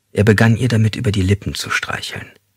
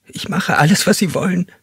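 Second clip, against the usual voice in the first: high, frightened begging; helpless pleading; 11 semitones higher than usual. Acted. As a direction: beg him not to hurt her, voice up high. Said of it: "flehentlich und hilflos". (hoch und flehentlich, voller Angst)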